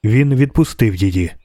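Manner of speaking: plain reading voice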